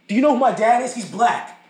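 hammed-up indignant yell; mock yell. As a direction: demand it in mock outrage. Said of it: "He's just gonna yell at you".

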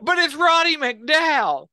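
high, loud and slow through laughter; cracking up mid-sentence; real laughter breaking the words; laughing. Real, not acted. Cracking up at the memory.

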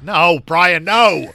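loud, emphatic refusal; mock scolding. Real, not acted. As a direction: come down hard on both no's. Mock scolding co-host about subtitles.

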